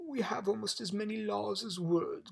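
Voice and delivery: mildly irritated objection; grumbling. A narrator's reading. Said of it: "grumbled the king".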